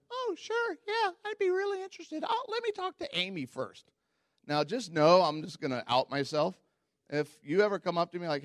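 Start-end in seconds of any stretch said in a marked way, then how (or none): 0.1-3.9 very high, sugary fake eagerness; passive-aggressive sweetness. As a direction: gush politely while meaning no. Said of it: "my little passive-aggressive self went".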